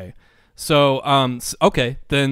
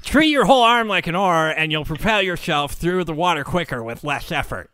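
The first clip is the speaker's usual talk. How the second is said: know-it-all nerd; 4 semitones higher than usual. Acted. Know-it-all swimmer instructing the beginner, nerd voice. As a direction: show off like an insufferable nerd. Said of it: "I'll do the know-it-all nerd voice".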